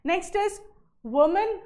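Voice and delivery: normal talking voice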